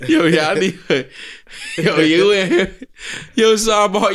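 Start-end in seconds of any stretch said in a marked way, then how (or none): 1.8-4.1 laughing. Real, not acted. Laughing while starting question.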